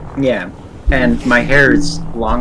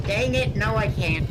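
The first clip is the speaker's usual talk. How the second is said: frustrated squeak; 10 semitones higher, 5 dB quieter than usual. Real, not acted. Copy fails, frustrated.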